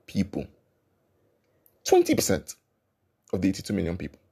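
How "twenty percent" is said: "Twenty" jumps very high; stress on Twenty. Real, not acted. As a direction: fling "Twenty" up high in disbelief. Restated figure even higher.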